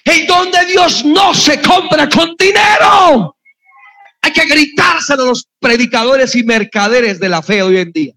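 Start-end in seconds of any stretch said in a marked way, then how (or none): 0.0-3.4 raised, slow, every word driven home; slow shouted proclamation. Real, not acted. Shout it to the crowd, slow and firm. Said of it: "Voy a darles otra oportunidad".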